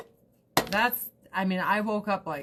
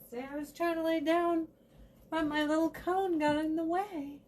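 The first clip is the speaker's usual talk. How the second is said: high little cat voice, much higher; voicing the cat; 9 semitones higher than usual. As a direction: speak for the cat in a high pitch.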